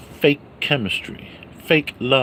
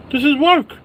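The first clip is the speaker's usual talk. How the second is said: This is work squeaked up high and louder; high squealed insistence; 14 semitones higher, 6 dB louder than usual. Acted. Vlogger insisting streaming is work, falsetto.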